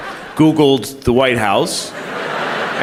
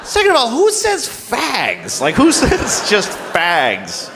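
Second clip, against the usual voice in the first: much higher, slow indignant rant; outraged; 10 semitones higher, slower than usual. Retold or imitated. Telling off the president on the phone, shrill mock outrage. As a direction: scold the president like you're appalled. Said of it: "And I was like".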